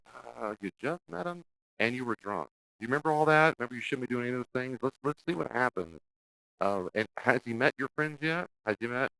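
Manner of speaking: high, gently probing concerned friend; a hint of a skeptical friend checking in; worried friends. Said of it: "your friends and family go".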